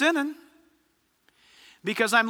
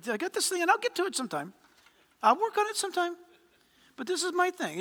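sheepish excuse voice; 6 semitones higher, 6 dB quieter, faster than usual. Retold or imitated. Preacher voicing the holdout, higher and quieter.

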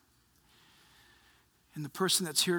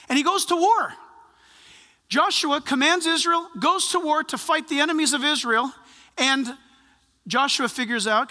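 raised, driving sermon voice; preaching; 9 semitones higher, 8 dB louder than usual. Acted. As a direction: build the battle story with fire. Preaching the Joshua story.

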